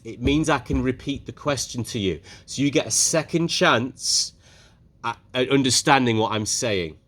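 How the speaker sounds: ordinary delivery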